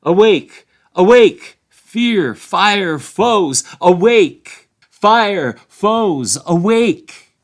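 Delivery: very loud, high, ringing calls; rousing alarm cry. Acted. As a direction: cry it like a horn waking the village. Horn call of Buckland voiced.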